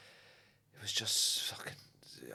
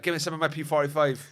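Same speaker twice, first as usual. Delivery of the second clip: louder, higher indignant demand; storming out; 6 semitones higher, 7 dB louder than usual. Retold or imitated. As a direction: demand it on the way out the door. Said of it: "storming out".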